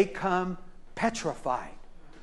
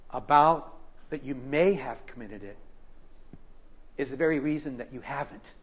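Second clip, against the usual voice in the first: gentle reassurance; 3 semitones lower, 7 dB quieter than usual. Retold or imitated. Pastor comforting a frightened man.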